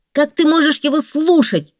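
high, loud outraged cry; man protesting the lion's warning. A narrator's reading. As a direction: shout in alarm. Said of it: "вскричал человек".

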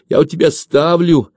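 heated, threatening retort. A narrator's reading. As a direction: snap back menacingly. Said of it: "угрожающе и тяжело дыша".

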